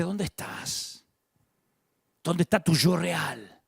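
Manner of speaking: slow, hushed, gentle asking; tender question. Pretend. Gentle voicing of God.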